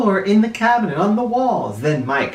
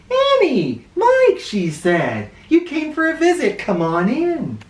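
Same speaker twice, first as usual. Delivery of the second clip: a lightly voiced, much higher friendly lady; Miss Reed's voice; 11 semitones higher than usual. A narrator's reading. Miss Reed greeting the children at her door, higher friendly voice. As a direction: welcome them in as a cheerful librarian.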